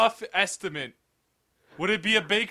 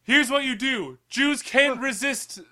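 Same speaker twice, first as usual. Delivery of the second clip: loud, raised rescue coaching; urgent mock instructions; 5 semitones higher, 3 dB louder than usual. Friend coaching pretend captive to escape, urgent shouting. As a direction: project it like emergency orders.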